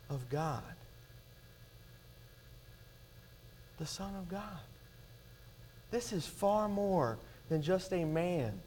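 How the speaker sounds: soft reverent hush over the crowd; hushed awe. Real, not acted. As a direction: let the words sink in, almost breathing them. Hushed awed preaching.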